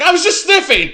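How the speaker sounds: high, loud protest; embarrassed husband blurting an excuse; defensive yelp. Retold or imitated. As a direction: squeak out a caught-out denial. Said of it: "And I'm like".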